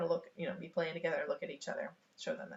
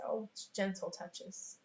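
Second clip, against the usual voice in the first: soft, slow soothing correction; gentle reminder; 8 dB quieter, much slower than usual. Acted. Adult to children whacking each other, gently reminding. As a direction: ease squabbling children down softly.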